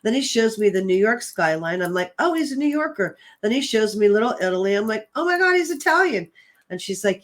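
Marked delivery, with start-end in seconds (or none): 2.2-3.2 higher, sudden realisation; surprised realisation. Retold or imitated. Realising where spirit is from. 5.2-6.3 high, fast, excited gasp of recognition; excited discovery. Retold or imitated. Excited realisation during reading.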